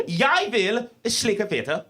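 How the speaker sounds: "Jeg vil slikke fitte" said very slowly and clearly in Norwegian; Norwegian phrase demo. Shown as a demonstration. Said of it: "the way that you say".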